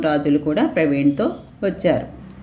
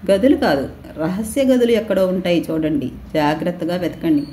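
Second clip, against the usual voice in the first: ordinary delivery, nothing marked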